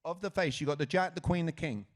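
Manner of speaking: loud, rapid-fire choices. Real, not acted. Host pressing a volunteer to pick a suit, rapid-fire. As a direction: fire the options out loudly, no hesitation. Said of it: "Even quicker".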